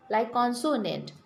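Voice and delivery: usual speaking voice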